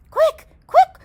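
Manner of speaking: high, loud panicked cry; urgent cry. Acted. Third pig calling his brothers inside, high and loud. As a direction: shout it high in alarm. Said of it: "cried the third pig".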